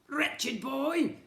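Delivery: put-on high, loud sneer at the boy; nasty jeer. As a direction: spit the insult at him. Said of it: "Edmond would sh-, agree".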